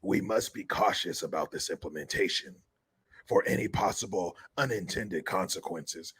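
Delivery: low raspy mock reading of his statement; Newsom impression. Mocking Newsom's signing message, low rasp. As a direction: read the quote in a low sleazy rasp. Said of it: "Newsom said".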